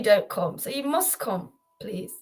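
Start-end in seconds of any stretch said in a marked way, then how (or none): none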